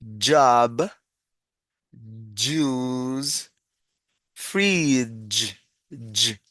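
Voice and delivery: "job, juice, fridge" spaced out with firm voiced J; articulated J sound. Shown as a demonstration. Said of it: "Entonces decimos".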